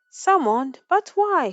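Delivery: father caught off guard by the summons; startled question. A narrator's reading. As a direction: blurt it in alarm. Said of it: "her father asked".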